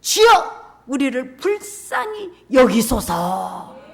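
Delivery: loud, slow desperate plea; a blind man crying out for mercy. Acted. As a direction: call out to the Lord, drawn out. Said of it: "소리 질러 이르되".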